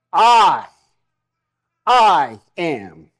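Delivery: "I," hit loud, then a pause before "I am"; emphatic repeated "I," with a beat before "I am"; stress on I. Shown as a demonstration. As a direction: lean hard on the first "I," and repeat it. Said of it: "the emphasis is on the I".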